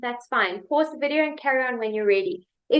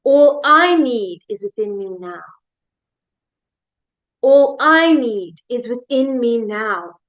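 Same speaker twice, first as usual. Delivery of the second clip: "I" hit loud and lifted after "All"; slow affirmation punching the word "I"; stress on I; 4 dB louder, slower than usual. Shown as a demonstration. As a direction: lean hard on "I", then ease off. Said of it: "we're gonna change the emphasis again".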